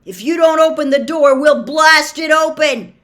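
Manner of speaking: loud, high, forceful warning; angry, insistent shout; shouted threat. A narrator's reading. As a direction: yell an ultimatum through the door. Threat shouted through the door.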